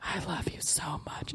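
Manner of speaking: soft whisper in the ear; right in your ear. Shown as a demonstration. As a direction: whisper it close and intimate. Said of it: "is what I would say right in your ear".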